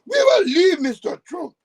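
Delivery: very high, loud wailing shriek; mock screaming. Mocking the woman pleading with Mister Trump, shrieked. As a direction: imitate her screaming plea at full pitch. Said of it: "that bitch that was screaming".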